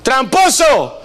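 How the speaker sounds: high, loud mother's call across the house. Retold or imitated. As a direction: yell for the kid like an exasperated mom. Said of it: "Imagínate la mamá".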